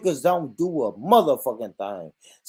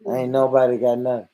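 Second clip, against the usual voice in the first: usual speaking voice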